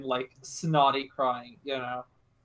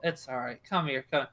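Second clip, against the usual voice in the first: soft, low reassurance; hushed and soothing, slightly lower; comforting, gentle; 3 semitones lower, 6 dB quieter than usual. Acted. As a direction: coax a child gently. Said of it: "a bit more comforting, gentle".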